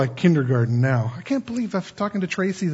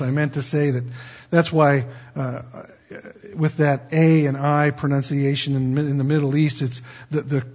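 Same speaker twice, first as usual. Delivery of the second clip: ordinary delivery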